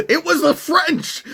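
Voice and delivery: ridiculing cry in a squeaky put-on voice; mocking outburst. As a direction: yelp it like a crank who just cracked the case. Mocking the new conspiracy theory.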